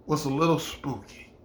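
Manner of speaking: ordinary talk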